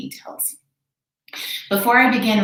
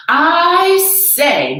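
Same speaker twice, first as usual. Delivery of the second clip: Horton murmuring; 9 semitones higher, 7 dB louder than usual. Acted. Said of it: "murmured Horton".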